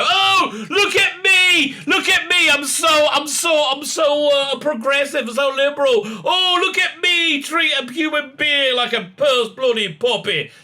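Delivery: high, loud preening sneer; mocking smug liberal. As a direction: parody a self-congratulating reader. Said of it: "and go".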